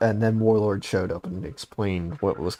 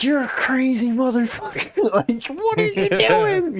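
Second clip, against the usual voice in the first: much higher, laughing through the words; laughing disbelief. Retold or imitated. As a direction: laugh at how crazy he is. Friend survived angel, disbelief.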